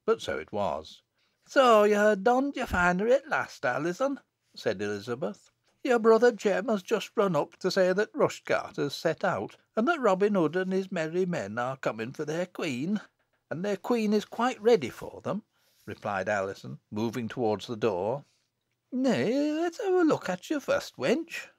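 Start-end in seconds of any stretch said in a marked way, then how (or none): 1.5-13.0 high-pitched country mother; Elizabeth, village mother. Acted. Mother to daughter in festival finery, high country voice. 13.5-15.4 put-on high-pitched village girl; daughter's ready reply. Festival queen answering her mother, high girlish reply. 18.9-21.5 very high country mother's call; mother's cry. Acted. Mother stopping her daughter at the door, high cry. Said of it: "cried Elizabeth".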